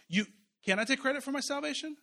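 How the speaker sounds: ordinary talk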